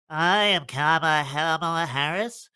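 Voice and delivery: slow, pinched-nose mock impression; mocking Kamala Harris. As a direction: squeeze the nose shut and drag it out mockingly. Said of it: "just pinch your nose".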